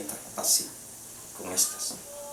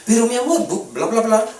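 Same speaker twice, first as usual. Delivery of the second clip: pleading husband; 10 semitones higher, 4 dB louder than usual. Retold or imitated.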